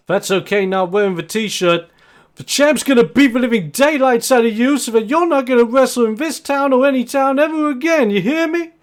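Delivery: loud, high threat; threatening shout. Acted. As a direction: yell the threat at him. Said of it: "as he shouts that".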